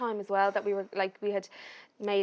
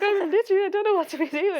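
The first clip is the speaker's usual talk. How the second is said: much higher, louder giddy rush; overwhelmed excitement; 11 semitones higher, 7 dB louder than usual. Retold or imitated. Replying to her crying friend, much higher and louder. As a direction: gush like you can't hold it in.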